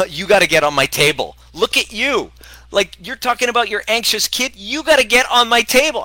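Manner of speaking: loud, high, mock yell at a mother; mock yell at mom. Chiropractor yelling at an anxious mom, loud mock rant. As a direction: bark it at the mom like a fed-up chiropractor. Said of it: "I'm like, "Motherfucker".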